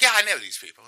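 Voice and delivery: put-on English voice; mock English accent. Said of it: "not that he has an English accent".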